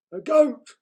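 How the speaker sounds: high, loud troll cry of joy; excited cry. A narrator's reading. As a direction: shout it out as the delighted troll. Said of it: "he cried".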